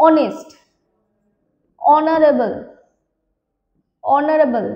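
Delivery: অনেস্ট and অনারেবল said loud, vowel first, no h; silent h. Shown as a demonstration. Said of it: "এইচ গুলোকে silent রাখছি". (এইচ নীরব রেখে জোরে উচ্চারণ)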